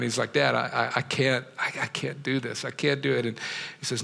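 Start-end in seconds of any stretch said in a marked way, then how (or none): 0.3-3.2 put-on hushed, broken pleading of a crying boy; a tearful son choking out the words, quiet; retold crying. Pastor voicing the crying son in a story, quiet and broken. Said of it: "the kid starts crying".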